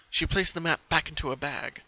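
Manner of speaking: plain reading voice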